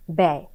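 ব্যয় said as "bæy", with an open e sound; pronunciation demo. Shown as a demonstration. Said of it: "যখন আমরা বলছি ব্যয়".

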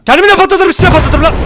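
high, loud angry threat. Real, not acted. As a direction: shout the threat in fury. Furious threat at enemies. (öfkeli, yüksek sesle tehdit)

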